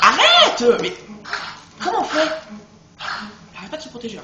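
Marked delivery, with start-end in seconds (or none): 0.0-2.3 high, loud yelled stop; frustrated shout. Real, not acted. Gamer yells at a spider that keeps blocking. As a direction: yell at the enemy to quit it.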